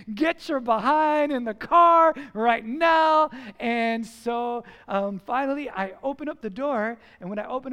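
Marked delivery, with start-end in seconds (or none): 0.1-3.3 put-on loud, drawn-out yell at the kids; threatening yell. Frustrated father yelling at his boys to hurry, threatening yell. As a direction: bellow it slowly, as a threat. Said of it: "I start yelling and threatening".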